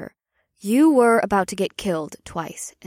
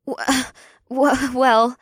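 sputtering; 5 semitones higher, 7 dB louder, slower than usual. Acted. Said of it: "Janine sputtered".

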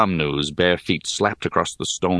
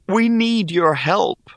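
pleading; 12 semitones higher, slower than usual. Acted. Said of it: "I pleaded".

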